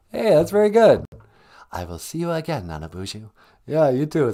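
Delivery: ordinary talk, nothing marked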